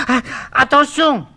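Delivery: sharp frightened gasp, then a high cry; frightened high warning cry; alarmed gasp. Acted. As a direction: gasp and shout a warning in panic. Eurydice warns Orphée not to turn.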